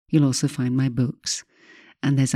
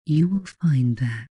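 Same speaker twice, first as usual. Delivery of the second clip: hypnotic voice. Acted. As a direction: drift each phrase out gently. Leads a hypnosis session.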